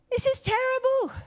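very high, dismayed exclamation; aghast prophet. Retold or imitated. Prophet Isaiah scolding Hezekiah for showing off, high dismay. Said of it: "Isaiah came back and went".

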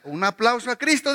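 ordinary delivery

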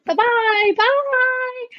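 very high, drawn-out goodbye; cartoonish singsong send-off; singsong farewell. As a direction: trill a long mock goodbye way up high. Acts out banishing the voice.

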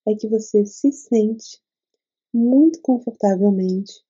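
ordinary talk, nothing marked

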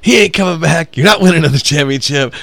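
loud, high retort to a crowd; heckler put-down. Retold or imitated. Comic fed up with the crowd yelling about Jordan, loud retort. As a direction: fire back loudly at hecklers, acting it out.

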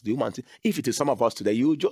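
ordinary talk, nothing marked

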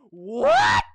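high shouted disbelief; stunned what. Real, not acted. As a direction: yelp it in shock. Stunned by stats.

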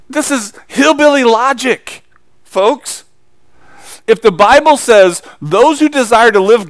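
loud, high, driving sermon; full-force preaching. Acted. Preacher rousing his congregation, loud and fervent. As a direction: preach it hard to the pews.

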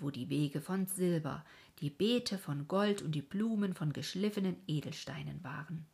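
ordinary delivery

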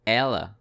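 ela with a clear open E; model pronunciation. Shown as a demonstration. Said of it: "you will find this sound in the word".

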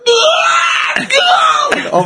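high-pitched goal celebration yell; retold scream. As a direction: re-enact a screamed goal cry. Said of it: "I'm screaming".

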